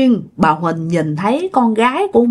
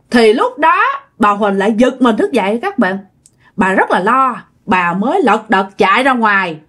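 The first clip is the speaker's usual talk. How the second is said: plain reading voice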